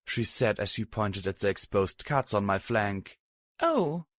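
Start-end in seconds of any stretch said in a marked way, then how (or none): 3.6-4.1 higher, light and easy; lightly. A narrator's reading. Tired mare unbothered by Glory, light. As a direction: toss it off lightly. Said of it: "I replied lightly".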